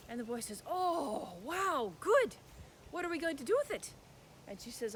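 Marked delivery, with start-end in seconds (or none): 0.7-3.9 put-on higher, quicker thrilled boy; boy delighted at the found egg; excited boy. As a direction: jump at the news.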